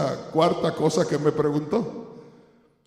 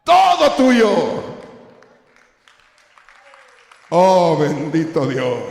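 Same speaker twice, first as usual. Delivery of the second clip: overwhelmed worship, slow at the end; exultant cry; 5 dB louder, slower than usual. Retold or imitated. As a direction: cry it out in joy. Climax of retold encounter.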